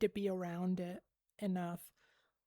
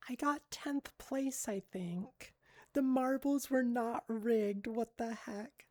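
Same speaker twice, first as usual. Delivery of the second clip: normal talking voice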